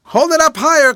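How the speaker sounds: high, loud call across water. A narrator's reading. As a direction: holler it over to the other boat. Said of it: "called Miss Susie".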